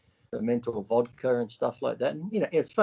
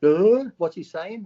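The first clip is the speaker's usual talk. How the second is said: mock dim voice; 7 semitones higher, 8 dB louder, slower than usual. Mocking a confused person.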